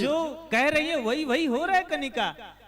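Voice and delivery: high, loud gushing praise; excited. Real, not acted. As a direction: cheer her on with delight.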